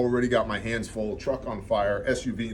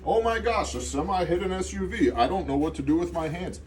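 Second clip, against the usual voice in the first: high, mocking flustered-bystander voice; hammed-up dither, high but not shouted; panicked bystander; 7 semitones higher than usual. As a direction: imitate a helpless onlooker, pitch way up. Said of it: "people who are wandering around on the road".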